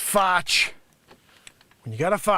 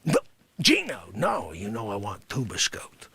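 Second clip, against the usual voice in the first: normal talking voice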